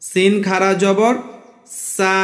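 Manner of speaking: drawn-out সা; long vowel sa. Shown as a demonstration. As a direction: stretch সা to one alif length. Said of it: "এক আলিফ টান দিতে হবে".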